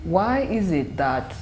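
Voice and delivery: usual speaking voice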